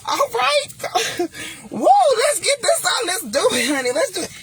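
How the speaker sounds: high, loud, gleeful mock eagerness; giddy excitement. A touch of mock excitement, imitating an eager woman. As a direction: ham up a giddy woman who can't wait. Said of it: "And you like".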